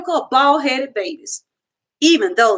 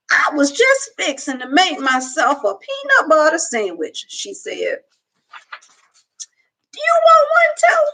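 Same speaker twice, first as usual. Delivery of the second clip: put-on amused old lady watching the dog eat; old woman talking to a girl about her dog; old Southern woman; 4 semitones higher than usual. A narrator's reading. Gloria Dump voiced.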